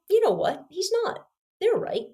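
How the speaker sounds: high-pitched, softened sudden concession; retold change of mind. Student sitting down to the exam concedes, high-pitched realisation. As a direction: act out giving in, pitch jumping up.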